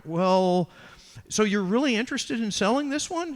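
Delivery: lightly voiced painter, sweating over a sale; nervous [name].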